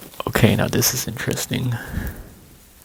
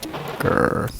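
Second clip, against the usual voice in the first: mock dog growl.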